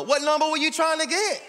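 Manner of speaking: high, loud preacher's shout; full-voiced pulpit shouting; preaching at full force. Real, not acted. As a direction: preach it out hard to the congregation. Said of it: "I'm preaching".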